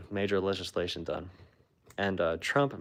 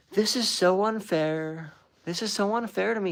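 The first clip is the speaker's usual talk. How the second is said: whiny Trump impression; 12 semitones higher, 4 dB louder than usual. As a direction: pout it like a sulky teenage girl. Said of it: "he's like".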